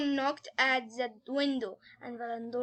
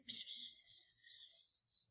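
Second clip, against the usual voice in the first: hissed psst-psst with no voice; whispering noise; 10 dB quieter, much slower than usual. Shown as a demonstration. Storyteller showing what whispered means, hissed whispering noise. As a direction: imitate whispering, all breath. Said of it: "Means say like this".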